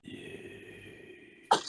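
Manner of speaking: breathy menacing growl; low growl. Acted. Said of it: "that's a growl".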